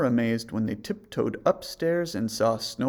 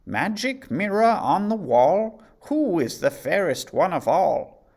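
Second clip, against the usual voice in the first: imperious, loud, high call to the mirror; a queen's commanding chant; queen addressing her mirror; 7 semitones higher, 7 dB louder than usual. A narrator's reading. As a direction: summon the mirror grandly. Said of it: "she stood before it and asked".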